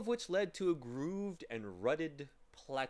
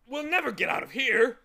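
loud, high-pitched moan; hammed-up hopeless moan; 9 semitones higher, 11 dB louder than usual. A narrator's reading. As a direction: wail it in despair. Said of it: "the humbug moaned".

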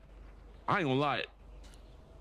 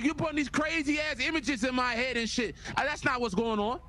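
high, raised exasperation; exasperated; 10 semitones higher, 4 dB louder than usual. Real, not acted. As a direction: push back, offended and loud. Upset at crude question.

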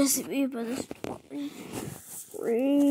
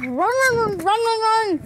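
high panicked shouting; being chased; 10 semitones higher, 11 dB louder than usual. Pretend. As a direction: yell it while fleeing. Fleeing in panic.

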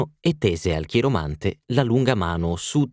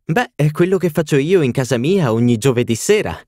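lively exclamation; 7 semitones higher, 5 dB louder than usual. A narrator's reading. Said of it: "esclamò Lady Windermere e rise".

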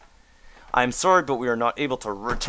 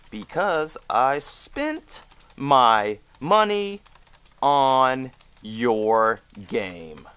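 dramatic pause; 3 semitones higher, 4 dB louder, much slower than usual. Pretend. Dramatic plea in the survey.

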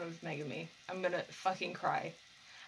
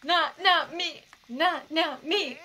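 mock panicked whine; 8 semitones higher, 7 dB louder, slower than usual.

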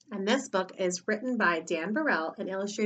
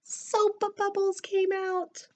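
slow singsong storytelling reveal; surprise reveal; 11 semitones higher, much slower than usual. A narrator's reading. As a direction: let it float out slowly and high.

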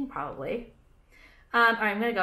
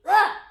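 disgusted ugh; 12 semitones higher, 10 dB louder than usual. Real, not acted. Disgusted at women's clothes.

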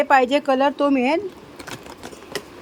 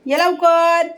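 calling the dog; 5 semitones higher, 5 dB louder, much slower than usual. Real, not acted.

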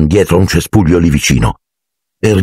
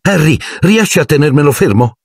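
much higher, eager asking; eager boy; 9 semitones higher than usual. Acted. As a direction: beg Harry to hold him. Colin asks, eager. (richiesta eccitata e acuta)

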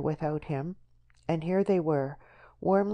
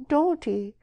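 protest; 11 semitones higher, 6 dB louder than usual. Acted. Deborah protests sharply.

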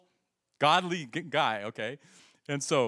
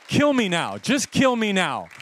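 mock despair; 5 semitones higher, 9 dB louder, slower than usual. Friend humbled after the healing.

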